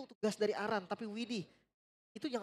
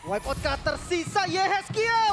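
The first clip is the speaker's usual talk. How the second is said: slow, loud, much higher shout; fighting game announcer; 9 semitones higher, 9 dB louder, slower than usual. Real, not acted. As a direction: proclaim the finish at full voice. Casters calling game end.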